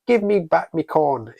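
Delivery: a playful leprechaun in an Irish lilt; hint of an Irish leprechaun; cute little Irish accent. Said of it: "in his cute little Irish accent".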